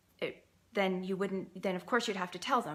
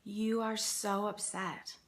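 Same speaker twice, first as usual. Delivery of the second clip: empathetic demo line; 2 semitones higher, much slower than usual. Shown as a demonstration. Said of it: "And say to your child".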